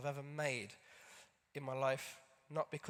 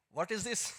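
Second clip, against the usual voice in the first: a lightly put-on Wolverhampton immigrant dad accent, a touch higher; horrible impression of dad; 5 semitones higher than usual. Son shows dad the scan, dad asks in a hammed-up accent. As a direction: play his dad badly and mockingly, with a thick accent. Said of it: "horrible accent and horrible impression of my dad".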